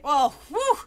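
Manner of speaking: ordinary delivery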